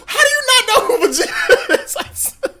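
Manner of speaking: very high, loud squeal breaking into laughter; laughing disbelief. Retold or imitated. Customer grilling barber about Vegeta, incredulous and laughing. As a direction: demand it incredulously while cracking up. Retold confrontation collapsing into laughter.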